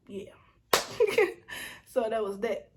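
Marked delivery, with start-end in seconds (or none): 0.7-1.8 high, breathy laugh bursting out; burst of laughter. Real, not acted. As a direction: laugh out loud at yourself. Laughs at own story.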